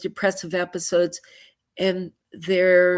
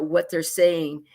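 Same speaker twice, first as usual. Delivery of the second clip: ordinary talk, nothing marked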